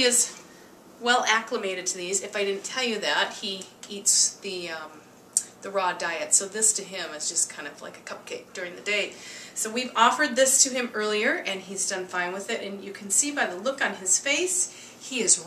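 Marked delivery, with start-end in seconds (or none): none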